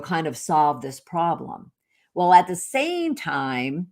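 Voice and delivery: ordinary talk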